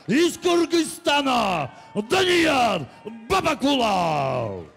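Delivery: slow drawn-out shouted name; booming stage introduction. Acted. Announcer calls fighter name.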